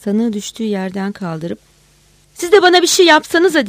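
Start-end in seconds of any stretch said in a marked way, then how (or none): 2.3-3.7 high, loud frustrated shout. A narrator's reading. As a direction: yell it to provoke him. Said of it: "diye bağırdı".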